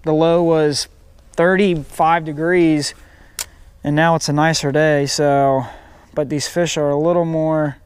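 normal talking voice

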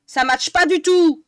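loud, exasperated outburst; frustrated yell; annoyed shout. Real, not acted. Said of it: "je commence à gueuler".

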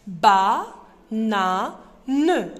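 each syllable punched loud and separate; slow syllable-by-syllable spelling for kids; syllables split apart. Shown as a demonstration. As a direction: clap out ba, na, ne one by one. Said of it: "c'est avec trois syllabes".

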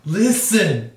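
loud high fairy call; shouted Navi call. Retold or imitated. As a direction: yell it like the fairy.

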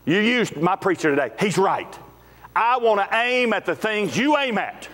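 preacher voicing a listener's prayer, swelling into a shout; voiced prayer. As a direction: pray it out, building to full preaching force. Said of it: "you walk out of here and go".

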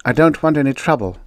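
acted petulance; petulant. A narrator's reading. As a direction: complain like someone put out. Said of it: "the blonde said petulantly".

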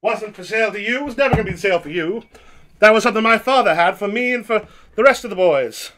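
put-on loud, high mid-Atlantic accent tirade; old movie tough-guy anger. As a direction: shout it like a forties film star. Old-movie quarrel improv.

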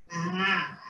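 normal talking voice

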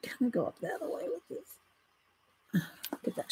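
soft whisper to herself; whispered aside over the painting. Real, not acted. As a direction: murmur it under your breath while working. Quiet aside while painting.

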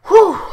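a loud, very high whew of excitement; excited whew. Real, not acted. Host too worked up to sleep, loud excited whew. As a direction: blow out the excitement in one big whew.

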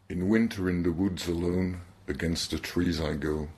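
unhurried verse reading, bilingual; poem reading. Acted. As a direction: read each line like a poem aloud. Reads poem opening.

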